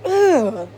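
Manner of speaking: a real "ugh" of revulsion; disgusted groan. Real, not acted. Sick of the actor's behaviour, disgusted groan.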